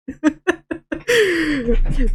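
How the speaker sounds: high amused laugh. Real, not acted. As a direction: laugh freely. Laughing after ignoring chat.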